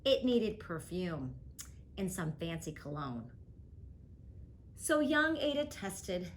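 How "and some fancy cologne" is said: ordinary delivery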